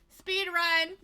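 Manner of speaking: loud, high hype call; excited hype shout. Real, not acted. Streamer hyping the speedrun, loud excited shout. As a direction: announce it like a race start.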